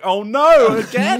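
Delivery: loud, high dismayed "oh no"; horrified gasp. Retold or imitated. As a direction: react like bad news again. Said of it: "And I was like".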